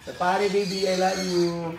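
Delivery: soft cooing endearment to a pet; hushed, tender pet talk. Real, not acted. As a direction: coo it gently at the animal. Cooing baby talk to animal.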